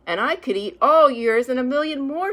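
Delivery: bragging loud and high; loud boast. Acted. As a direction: top everyone with a big brag. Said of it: "said Spike".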